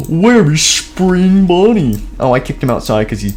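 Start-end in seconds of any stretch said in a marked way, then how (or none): 0.1-2.0 alarmed. Acted. Friend worried for missing friend.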